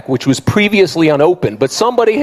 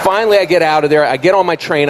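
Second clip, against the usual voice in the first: ordinary talk, nothing marked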